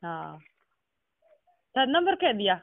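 ordinary delivery

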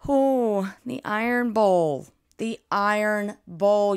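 loud, slow, drawn-out hype; hyped announcement. Real, not acted. Vlogger hyping the Iron Bowl to viewers, loud and slow. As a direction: stretch out the Iron Bowl like a big reveal. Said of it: "hyping the big game".

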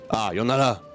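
normal talking voice

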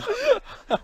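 shrill burst of laughter; real giggle fit; high squealing laugh. Real, not acted. Laughing at own impression.

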